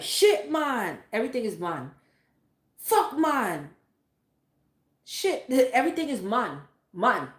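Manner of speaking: mock her mother's thick Jamaican patois; imitating mom's Jamaican patois. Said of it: "she be like".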